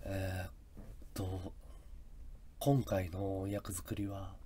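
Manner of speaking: low, very soft, drawn-out interview mumble; laid-back, husky actor's murmur; mumbling actor. Retold or imitated. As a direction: imitate an actor murmuring slowly through an interview. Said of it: "ボソボソ喋るでしょ".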